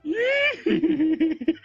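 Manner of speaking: high theatrical villain cackle; a sinister barber's delighted cackle; evil cackle. Acted. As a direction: cackle like a gleeful storybook villain. Villain cackles over drawing.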